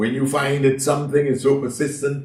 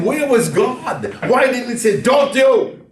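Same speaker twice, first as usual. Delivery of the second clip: much higher, louder, slow incredulous cry; indignant protest; 11 semitones higher, 5 dB louder than usual. Acted. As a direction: demand where God was. Preacher voicing the crowd's protest.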